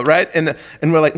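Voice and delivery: normal talking voice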